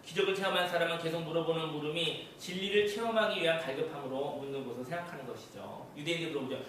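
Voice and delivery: ordinary talk, nothing marked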